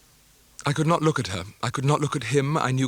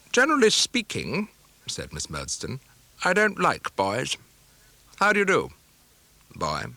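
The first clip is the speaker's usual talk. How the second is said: stern spinster; 4 semitones higher than usual. Acted. Stern spinster greeting the boy.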